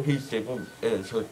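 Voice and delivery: ordinary delivery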